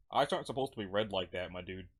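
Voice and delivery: ordinary delivery, nothing marked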